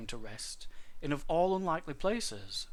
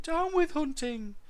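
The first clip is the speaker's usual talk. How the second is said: very high, slow dinosaur hoot; small duckbill's piping chant; 14 semitones higher, 5 dB louder than usual. A narrator's reading. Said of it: "he hooted".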